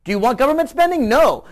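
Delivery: high, quick question, loud blunt NO; mock poll exchange. Host playing pollster and public, mock emphatic. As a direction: fire off the question, answer it firmly.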